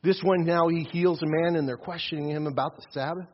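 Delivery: ordinary talk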